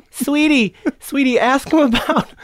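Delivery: loud, very high-pitched mock woman's voice; Andrew Dice Clay. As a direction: squeal it like a comic's caricature of a woman. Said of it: "that's my Andrew Dice Clay".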